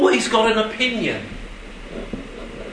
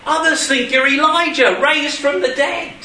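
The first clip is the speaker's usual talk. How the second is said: higher-pitched called-out answer; mock shout, pitched up; 7 semitones higher than usual. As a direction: call out an answer from the crowd. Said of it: "they started shouting out".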